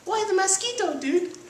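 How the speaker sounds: tiny, very high squeaky voice; squeaky cartoon pup; smallest chihuahua. A narrator's reading. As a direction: pipe up as the littlest dog. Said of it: "the smallest of the small ones spoke up".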